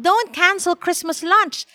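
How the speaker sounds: put-on high, urgent little girl; insistent child's high plea; pleading child. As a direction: beg and promise with all your might. Child begging grandmother not to cancel.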